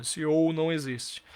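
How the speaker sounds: normal talking voice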